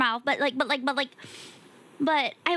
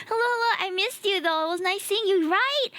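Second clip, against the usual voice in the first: ordinary talk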